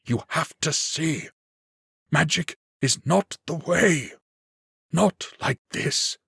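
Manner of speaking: put-on an old mage begging, voice shaking; voice trembling. A narrator's reading. Old mage pleading with the young lord, voice trembling. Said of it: "voice trembling".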